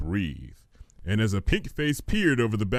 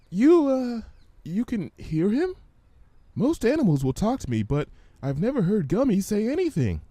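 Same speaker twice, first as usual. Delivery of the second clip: timid Fluttershy; 11 semitones higher than usual. Acted. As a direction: ask carefully, unsure. Fluttershy voice held.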